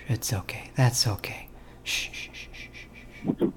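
soft, whispered comforting with shushes; mock soothing whisper. Prank caller pretending to console an angry victim, whispered. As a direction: hush them in a whisper as if comforting a child.

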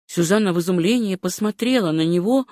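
ordinary delivery, nothing marked

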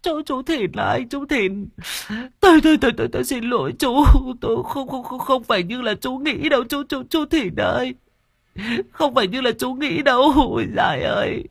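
high-pitched wailing, tripping over words; sobbing, stammering plea. A narrator's reading. Woman begging Thìn to believe her, bawling and stammering. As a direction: burst into tears and beg in broken stutters. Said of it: "Mụ Vi khóc toáng lên, mụ lắp bắp van xin".

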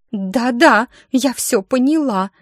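high, near tears, moaning; tearful whimper. Acted. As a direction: whimper it out almost crying. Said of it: "чуть ли не плача простонала".

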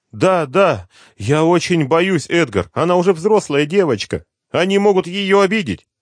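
high, loud, breathless rush of worry; a terrified mother pouring it all out; mother telling her son the girl was taken, in panic; panicked mother. Acted. Mother voiced, frightened.

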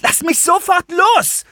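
very high, slow, forceful yell; shrieked, drawn-out demand; furious scream. A narrator's reading. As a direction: scream to be released. Girl struggles in man's grip.

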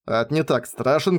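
ordinary delivery, nothing marked

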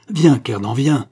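loud, urgent summons; urgent call. A narrator's reading. Count urges Kernan to follow him, urgent call. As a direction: call out insistently to hurry someone along.

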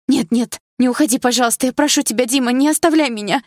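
high, loud desperate begging; frightened pleading. A narrator's reading. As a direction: beg him not to leave, in panic. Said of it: "испуганно вцепилась я в Диму". (испуганная мольба, громко и высоко)

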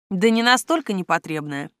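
ordinary delivery

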